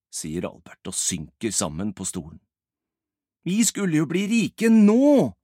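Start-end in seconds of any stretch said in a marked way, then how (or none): 3.5-5.3 loud, high-pitched complaint; whining protest. Acted. Albert protests that they should be rich now, whining loudly. Whining over lost riches.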